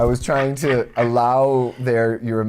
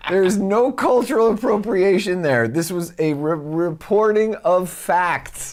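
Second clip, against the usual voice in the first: indignant; 11 semitones higher, 4 dB louder than usual. Acted. Heated mock-indignant argument.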